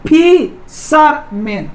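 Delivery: Fisherman slowly, pausing between फि, शर, मैन; three long separated beats on fisherman; split into syllables. Shown as a demonstration. Teacher showing students three syllables, split into syllables. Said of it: "उच्चारण करके देखना है".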